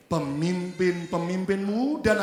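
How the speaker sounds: very slow, stretched-out syllables; unison scripture reading. Real, not acted. Preacher reading the verse with the congregation, drawn-out recitation. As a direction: lead the whole room in reading aloud.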